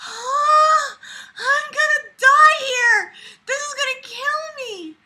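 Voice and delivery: very high, slow, hammed-up whimpering despair; mock crying. Suffering through the story, a mock sob. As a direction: pretend to sob that this is killing you.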